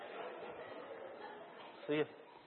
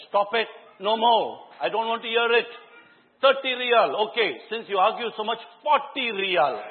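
loud and high, laying down the final word; mock stern shout; 7 semitones higher, 6 dB louder than usual. Father shutting down daughter's phone argument, loud stern shout. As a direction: end the argument like a fed-up father. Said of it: "At last I said".

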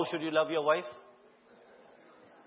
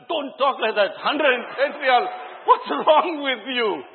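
loud, slow, incredulous retort, higher pitched; mock outraged father; 9 semitones higher, 9 dB louder, slower than usual. Father scolding daughter over the pricey phone, loud disbelief. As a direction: act out the shocked dad, drawing it out. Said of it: "I had a fight".

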